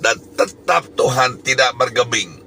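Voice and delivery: normal talking voice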